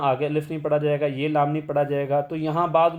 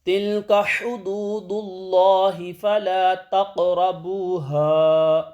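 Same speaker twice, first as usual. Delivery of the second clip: slow melodic tilawat, higher and louder; Quran recitation; 4 semitones higher, 4 dB louder, much slower than usual. Shown as a demonstration. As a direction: recite the verse in full chant. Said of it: "ایسے پڑھیں گے".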